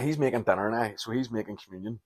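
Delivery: usual speaking voice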